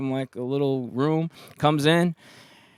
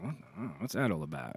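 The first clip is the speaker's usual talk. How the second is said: bewildered mutter; 6 semitones lower, 15 dB quieter, faster than usual. Retold or imitated. Confused muttering at customs desk.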